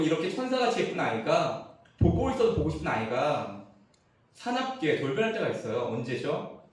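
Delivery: ordinary delivery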